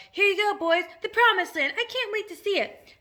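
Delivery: high, loud cheering; excited shouts. A narrator's reading. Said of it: "amidst mounting excitement and shouts of".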